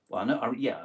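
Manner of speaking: ordinary delivery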